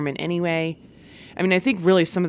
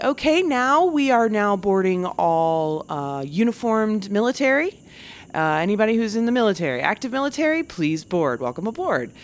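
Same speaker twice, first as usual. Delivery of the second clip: gate agent announcement; 5 semitones higher than usual. Retold or imitated. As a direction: announce military boarding. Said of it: "And like".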